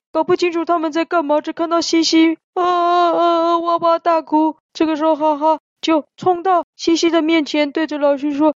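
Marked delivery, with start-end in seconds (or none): 2.5-4.6 drawn-out slow mock wail, child bawling; wailing cry. Haha sees Xixi crying in the office, drawn-out childish wail. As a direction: bawl like a small child, slowly.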